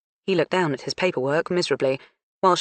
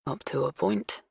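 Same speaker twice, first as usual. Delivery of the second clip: inner thought; 7 dB quieter than usual. Acted. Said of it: "James mentally added".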